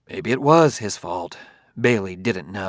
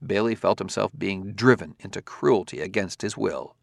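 usual speaking voice

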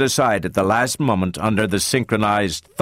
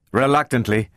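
ordinary delivery, nothing marked

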